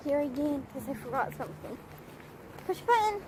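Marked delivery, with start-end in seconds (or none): 2.7-3.2 command cue. Real, not acted. Cue to dog.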